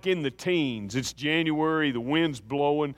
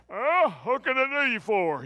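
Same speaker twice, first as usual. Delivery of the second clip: a lightly voiced country mechanic, pitched higher; folksy mechanic; 7 semitones higher than usual. Retelling the mechanic at the trailer door, lightly voiced folksy greeting.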